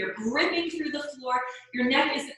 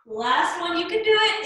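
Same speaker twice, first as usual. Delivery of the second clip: high, bright push of encouragement; rally them for the final rep; encouraging cheer; 7 semitones higher, 4 dB louder than usual. Real, not acted. Instructor cheering on the class.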